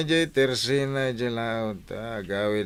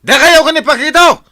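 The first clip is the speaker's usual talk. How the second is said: loud, high accusing yell; jealous husband explodes at his wife; furious shout; 14 semitones higher, 15 dB louder than usual. Acted. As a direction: roar the accusation at her. Husband accuses wife about Pakito.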